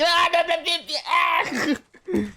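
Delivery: high spluttering yelps under spray; squealing nonsense sputter; sputtering shrieks. Acted. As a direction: splutter as water hits your face.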